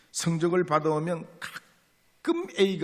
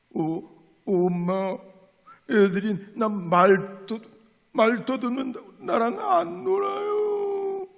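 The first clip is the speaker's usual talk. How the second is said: put-on stammering boy near tears, rising high at the end; tearful boy; 8 semitones higher, 3 dB louder than usual. Son telling mother the kids tease him, mock tearful. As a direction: whimper it like a bullied kid. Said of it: "울먹울먹하면서".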